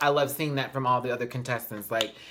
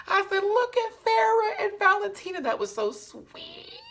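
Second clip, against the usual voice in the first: squealing gush. Retold or imitated. Vlogger recalling her reaction to the besties, very high squeal. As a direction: squeal it in delight. Squealing about sweet moment.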